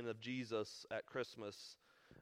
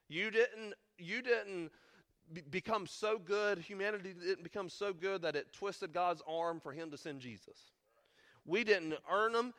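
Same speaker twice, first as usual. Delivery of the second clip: fervent preaching; 5 semitones higher than usual. Acted. Pastor insisting to the congregation that nobody earned the gift, fervent preaching. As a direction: press the point hard, climbing in pitch.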